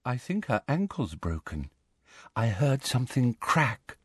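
soft, hushed voice of concern; whispered. A narrator's reading. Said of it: "whispered Luna".

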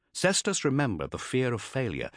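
ordinary delivery

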